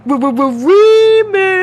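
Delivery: loud, high stuttered DJ drop; DJ-style shout. Pretend. Streamer showing off to viewers, playful shout. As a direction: belt it out like a club DJ.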